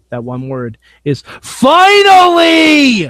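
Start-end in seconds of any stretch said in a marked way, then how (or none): 1.4-3.1 long, high, shouted exclamation; triumphant yell. Pretend. Giving the co-host one word for the show, triumphant yell. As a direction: belt it out loud and high, stretching every syllable.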